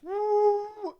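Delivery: high whooping woo like a whistle; a shrill high "woo". Retold or imitated. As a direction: mimic the officer's whistle cry. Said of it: "They'd be like".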